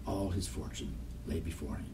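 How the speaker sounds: usual speaking voice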